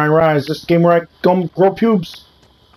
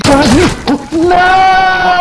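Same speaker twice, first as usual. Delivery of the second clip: loud high yell of no; despairing shout; 4 dB louder, much slower than usual. Real, not acted. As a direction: yell it as the round is lost. Losing the round.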